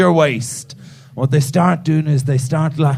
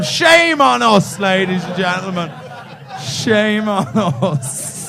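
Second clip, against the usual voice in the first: slow mock shame; 3 semitones higher than usual. Mock repentance to audience.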